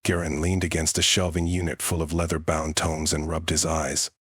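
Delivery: ordinary delivery, nothing marked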